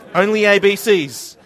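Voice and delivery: proclaimed loudly as a joke; loud declaration. Acted. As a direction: call it out to the room. Joking exclusive group hug.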